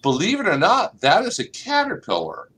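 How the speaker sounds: usual speaking voice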